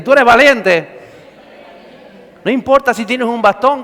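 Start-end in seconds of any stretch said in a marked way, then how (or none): 0.0-1.0 loud model line. Shown as a demonstration.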